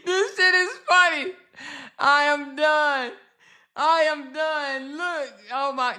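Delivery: laughing so hard it turns to tears; crying with laughter. Real, not acted.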